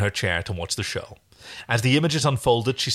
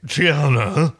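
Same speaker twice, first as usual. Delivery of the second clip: lightly voiced calling character, louder; a touch of a character voice, rising at the end; Pilot; 3 semitones higher, 6 dB louder than usual. A narrator's reading. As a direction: call the name out as a question. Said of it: "It's Pilot".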